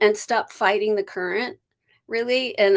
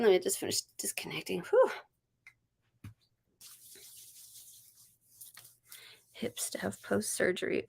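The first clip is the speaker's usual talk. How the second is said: whispered, much softer aside; 9 dB quieter than usual. Real, not acted. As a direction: mutter it to yourself. Whispered aside while reading.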